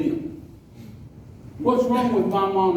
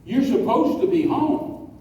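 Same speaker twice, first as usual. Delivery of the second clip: higher, drawn-out admonishing delivery; earnest rebuke; 4 semitones higher, slower than usual. Retold or imitated. Fifteen-year-old to a pastor planting bushes, earnest rebuke. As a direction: press each duty home slowly. Teenager scolds a pastor in story.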